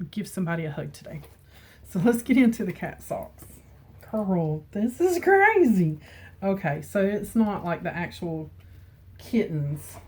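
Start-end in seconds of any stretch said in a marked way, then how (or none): 4.1-6.0 delighted disbelief. Real, not acted. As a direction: squeal at something ridiculous. Seeing the cat socks.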